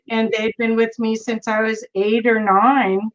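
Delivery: normal talking voice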